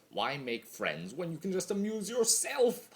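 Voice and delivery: high, loud scoffing question; mocking. Chaos spirit sneering at friendship, mocking. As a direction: scoff at the very idea.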